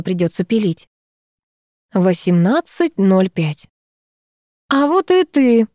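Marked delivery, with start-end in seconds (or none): 4.7-5.7 higher-pitched happy greeting to a pet; delighted greeting. A narrator's reading. Narrator greeting her cat on the sofa, delighted. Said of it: "обрадовалась я коту".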